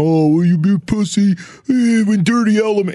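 tough guy voice. Retold or imitated. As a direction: sneer it like a macho bro. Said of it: "You'll get a bunch of Tommy Tough guys go".

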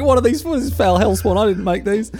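horrified dad. Pretend. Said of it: "He puts his glass, like".

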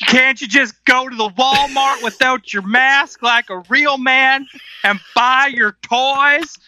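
hammed-up Southern accent. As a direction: taunt in a put-on Southern twang, stretching the last words. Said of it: "please read that in a Southern accent".

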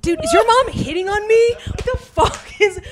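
high, loud panicked outburst; freaking out. Acted. Texting a boyfriend about his mom, freaking out. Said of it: "I'm freaking out".

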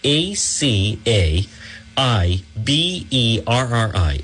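slow, each letter apart; slow letter-by-letter spelling; spelled out letter by letter. Shown as a demonstration. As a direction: spell it out slowly for listeners. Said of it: "it's just how it sounds".

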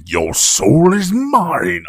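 slow, high, hammy villain cry; exaggerated movie-villain impression; over-delivered movie villain. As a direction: draw out the threat like an overacting villain. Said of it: "that dude over-delivered".

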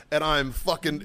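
ordinary delivery

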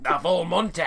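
put-on Scottish lilt; Scottish accent. Repeating the character's boast to co-host, mock Scottish accent. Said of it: "made him Scottish there".

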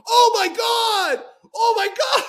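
slow, very high, loud gasping cry; shocked shout. Acted. Jack watching David jump off the roof, shocked shout.

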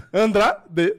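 Andrade said fast, two syllables; quick imitation. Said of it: "mas falou rápido".

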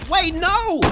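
high loud panicked shout; panicked cry. Acted. Comedian crying out at a pointed gun, high and loud. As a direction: cry out in alarm.

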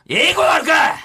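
loud comic retort; indignant retort. Real, not acted. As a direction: snap back, outraged. (怒ったツッコミ)

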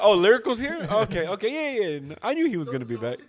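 high, loud imitation of a flustered co-host; mock flustered reaction. Host teasing a co-host over Lyrical's return, mock flustered. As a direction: start high and loud, then settle.

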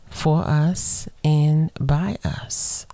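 said slowly, each word spaced out; slow, weighty emphasis; slow and deliberate. Shown as a demonstration. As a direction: drag it out for weight. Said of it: "Why she say that part so slow?".

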